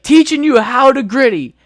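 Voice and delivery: loud high disbelieving repeat; incredulous drill sergeant. Retold or imitated. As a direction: echo it back in disbelief. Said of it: "He's like".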